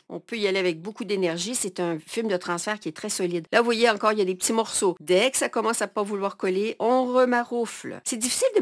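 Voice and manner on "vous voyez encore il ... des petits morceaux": ordinary talk, nothing marked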